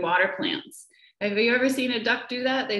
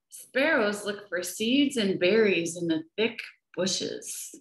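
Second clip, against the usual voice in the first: ordinary delivery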